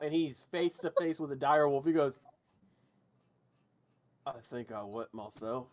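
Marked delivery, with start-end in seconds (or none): 4.3-5.7 put-on low, quiet drunkard; mumbled confession of a drunk; understated tipsy voice. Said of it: "this drunkard walks out of the diner".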